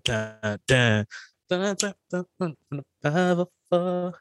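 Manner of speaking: dun dun dun mimicking drum drops; beat imitation. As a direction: vocalize the beat hitting. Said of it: "bring the drops in with a".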